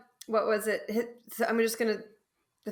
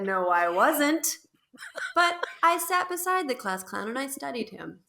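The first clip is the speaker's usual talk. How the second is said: quoting Alan Pearl; 4 semitones higher than usual. Retold or imitated. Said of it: "and I said".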